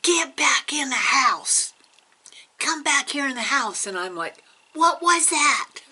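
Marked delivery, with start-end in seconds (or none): 0.0-3.9 loud, high bossy big-sister holler; imitated yell. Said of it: "and she yells". 4.7-5.8 very high, loud mock panic; slow, hammed-up shriek of a question; retold frightened yell. Frightened reply to yelling sister.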